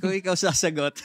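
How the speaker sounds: high, loud laughing words. Real, not acted. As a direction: laugh while tossing it back. Turns the question back, laughing.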